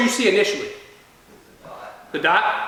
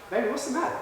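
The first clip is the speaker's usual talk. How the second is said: soft, higher-pitched motherly question; concerned mom; 5 semitones higher, 9 dB quieter, much faster than usual. Retold or imitated. Mom noticing child in the doorway, softly concerned. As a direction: imitate a gentle, worried mother. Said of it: "my mom looks over at me".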